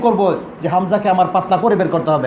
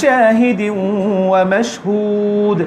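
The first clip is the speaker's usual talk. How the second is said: slow, easy chanted recitation; gentle Quran recitation; slower than usual. Shown as a demonstration. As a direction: recite without strain, drawn out. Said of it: "একদম এমনভাবে পড়তে হবে".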